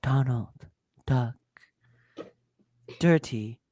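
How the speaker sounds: low, slow, soft tingles voice; a hushed mock ASMR channel opening; slow deep parody ASMR; mock ASMR intro. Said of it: "would just be like".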